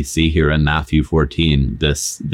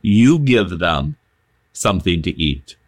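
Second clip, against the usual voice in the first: firm emphatic command, "You" held long. Shown as a demonstration. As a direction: lean hard on "You", then let the rest drop. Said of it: "I think Jesus is emphatic here".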